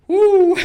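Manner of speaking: high, loud "woo" of exertion; mock out-of-breath whoop; winded whoop. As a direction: let out a big acted puff after the climb. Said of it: "I'm out of breath".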